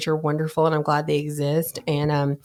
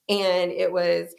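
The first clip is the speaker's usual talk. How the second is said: usual speaking voice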